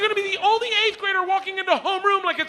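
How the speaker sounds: very high, incredulous comic rant; hammed-up fatherly outrage, voice shooting way up; outraged dad. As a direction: blow up in high-pitched disbelief. Dad outraged at tattoo request.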